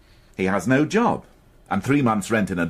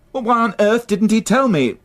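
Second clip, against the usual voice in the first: louder, higher exasperated outburst; higher, louder exasperation; 11 semitones higher, 5 dB louder than usual. Acted. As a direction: vent frustration down the phone. Inspector exasperated on phone.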